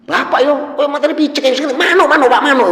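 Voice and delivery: put-on high and loud, furious at the old man; angry yelling. Son berates his father over a bird, angry high shout. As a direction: shout it like a son losing patience.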